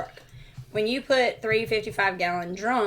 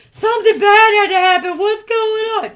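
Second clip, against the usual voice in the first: loud and higher, pressing for answers; panicked demand; 10 semitones higher, 8 dB louder than usual. Retold or imitated. Friend demands answers from the driver, loud and high. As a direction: push hard for what happened.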